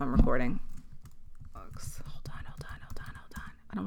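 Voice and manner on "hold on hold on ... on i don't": hushed, breathy "hold on, hold on"; urgent whisper. Real, not acted. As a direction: whisper fast to yourself while checking the recorder. Checks recording, whispers urgently.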